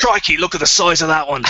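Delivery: mock Australian accent, playful. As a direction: play a mock Aussie adventurer spotting a big animal. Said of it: "A little bit Australian".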